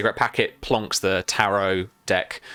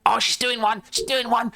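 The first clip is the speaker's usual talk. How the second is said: mice squeaking; 13 semitones higher than usual. Acted. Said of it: "they say, like".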